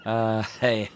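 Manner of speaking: normal talking voice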